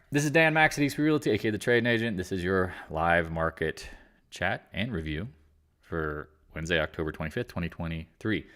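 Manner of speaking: announcer-style channel intro; show intro. Acted. Host opening the daily live market chat.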